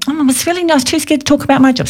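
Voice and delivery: high, timid mock-girly voice; silly voice. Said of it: "I shouldn't say that in a silly voice".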